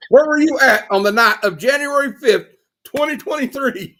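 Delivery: mock interrogator grilling a suspect; cross examination. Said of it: "I'm just kidding".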